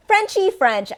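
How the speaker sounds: high, playful fake French accent on "Frenchy French"; teasing fake French accent; mock French accent. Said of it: "I say Frenchy French".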